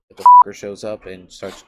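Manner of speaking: sudden furious shout. Acted. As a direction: blurt it out loud. Sudden outburst mid narration.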